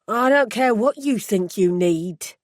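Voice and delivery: put-on stern, firm older woman's voice; a hard-edged woman blocking the way; gruff woman. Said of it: "a gruff female voice said".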